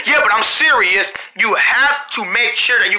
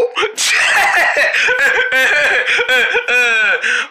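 long, stuttering giggle fit; hysterical laughter. Acted. Laughing at the funny voice.

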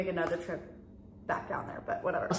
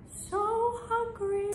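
high, slow, stretched so hungry; whiny; 13 semitones higher, 3 dB quieter, much slower than usual. Real, not acted. Vlogger whines to viewers about being hungry.

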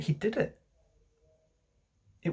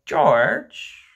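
loud, drawn-out pleading call; entreating; 2 semitones higher, 7 dB louder than usual. Acted. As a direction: entreat him by name, swooping upward. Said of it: "Missus Darling entreated him".

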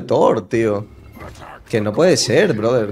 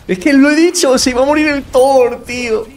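raging gamer; 11 semitones higher, 5 dB louder than usual. Real, not acted. Se queja del compañero.

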